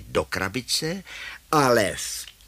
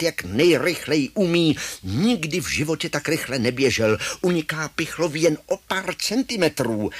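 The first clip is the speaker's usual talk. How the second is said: plain reading voice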